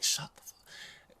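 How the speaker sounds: whispered. Real, not acted. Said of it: "exasperated reaction".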